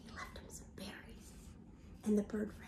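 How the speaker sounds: a breathy storytime whisper. Real, not acted. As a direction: whisper it like a secret to the class. Whispering to the children.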